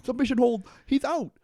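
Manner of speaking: high, loud mock referee call; comic ringside shout; fight announcer. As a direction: call the knockout in a squeezed high voice. Mimics fight commentary.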